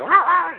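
high playful mock bark; imitating dog bark. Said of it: "Unless she barks at me".

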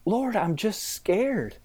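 scared prayer. Retold or imitated. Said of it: "Or like".

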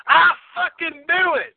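high, slow, triumphant yell; mock Southern outlaw gloating; imitating Walton Goggins. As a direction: crow it out slowly and way up high. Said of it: "he's just like".

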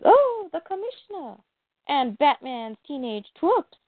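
theatrical mock delight; mocking Joker. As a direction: sneer it in a high singsong, stretching words. Storyteller voices Joker mocking his foes.

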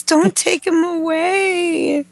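mock tearful pleading. Fan begging for Glenn to be spared, mock tearful plea. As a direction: plead like a fan, pretending to cry.

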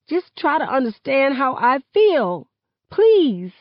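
high, strained plea; throat tight. A narrator's reading. Girl begging her friend to understand, tight high-pitched plea. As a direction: beg to be understood, voice climbing. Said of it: "my throat tight".

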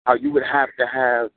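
rhythmic, weighty poem recital; spoken word poetry. Acted. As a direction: deliver it like a slam poem.

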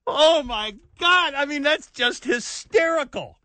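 slow, incredulous groan; can't believe what he's hearing; exasperated disbelief. Real, not acted. Outraged disbelief at the news.

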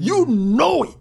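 loud commanding voice of God. Retold or imitated. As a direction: raise the voice like God rebuking. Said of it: "Now he raised his voice".

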